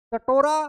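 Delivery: कटोरा said loud, leaning on the ओ in टो; ओ sound stressed. Shown as a demonstration. Teacher showing students the ओ sound, loud and stressed. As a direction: punch the ओ in कटोरा to show it. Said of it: "यहाँ तो ओ की ध्वनि निकल रही है".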